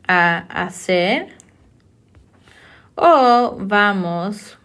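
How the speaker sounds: slow, drawn-out words with long pauses; slow dictation. Shown as a demonstration. Teacher writing example sentences for students, slow dictation. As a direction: spell it out at writing speed, phrase by phrase.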